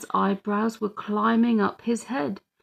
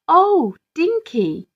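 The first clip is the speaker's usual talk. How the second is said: delighted surprise; 9 semitones higher, 6 dB louder, slower than usual. A narrator's reading. Mum sees tiny toilet.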